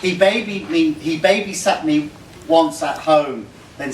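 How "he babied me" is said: usual speaking voice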